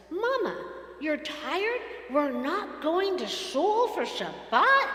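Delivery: put-on a worried four-year-old asking her mom; small child's voice. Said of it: "and Ella says".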